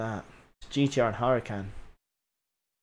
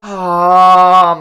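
frustrated cry; 4 semitones higher, 12 dB louder than usual. Real, not acted. Reacting to another crash.

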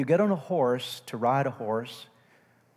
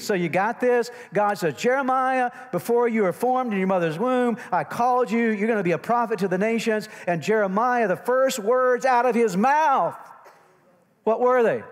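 loud, high sermon delivery; full-force preaching; 10 semitones higher, 7 dB louder than usual. Real, not acted. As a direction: preach it out to the whole congregation. Preaches to the congregation.

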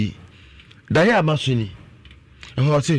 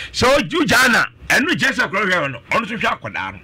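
normal talking voice

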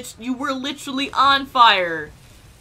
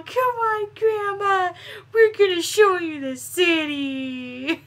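much higher, sweet coaxing tone; coaxing grandma; 7 semitones higher, slower than usual. Acted. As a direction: coax her along like a small child.

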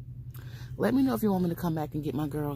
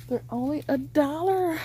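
higher, delighted repeat; excited; 8 semitones higher than usual. Real, not acted. Vlogger gushes to viewers about the one-dollar price. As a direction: sell the bargain with glee.

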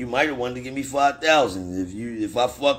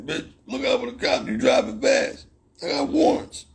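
higher, nervous imitated yelp; imitating a scared accomplice, pitched way up; panicky accomplice; 11 semitones higher than usual. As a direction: play the jittery partner yelling about cops. Voices panicky accomplice in car.

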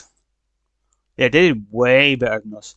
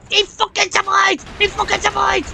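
incredulous outrage. Real, not acted. Gamer sees his target survive, incredulous. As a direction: squeal it in sheer disbelief.

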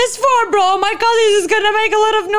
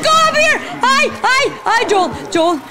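usual speaking voice

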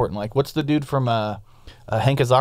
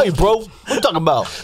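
put-on higher, loose joke Irish accent; mock Irish accent; 5 semitones higher than usual. Teasing co-host about talking, mock Irish accent. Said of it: "Yeah, it's Irish".

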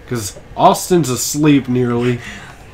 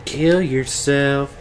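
giggling put-on Filthy Frank voice; Filthy Frank impression; 2 semitones higher than usual. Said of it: "Filthy Frank".

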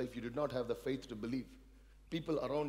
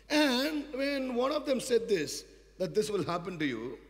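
loud, high, slow build; preaching surge; 10 semitones higher, 8 dB louder, slower than usual. Acted. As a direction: lift the congregation.

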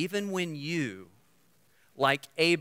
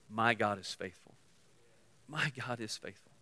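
soft declaration; 5 semitones lower, 9 dB quieter than usual. Acted.

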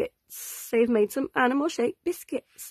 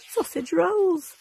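higher, slow and drawn out; excited; 6 semitones higher, slower than usual. Real, not acted. Finishing the recipe for viewers, drawn-out delight. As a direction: savour the words like a treat. Ending chapter on the treat.

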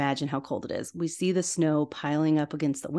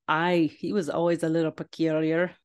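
attempted Scottish Scrooge accent. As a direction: imitate Scrooge with an amateur Scottish burr. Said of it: "I don't know if my accent is that good".

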